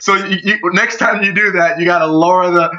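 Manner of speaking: ordinary delivery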